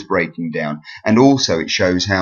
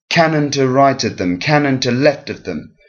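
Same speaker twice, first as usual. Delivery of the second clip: CANon to RIGHT of them, CANon to LEFT of them, higher pitched; dactylic stress; 4 semitones higher than usual. Shown as a demonstration. Teacher demonstrating stressed and unstressed syllables, dactylic stress. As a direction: beat Canon, right and left, lighten the rest. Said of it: "one stressed syllable and two unstressed".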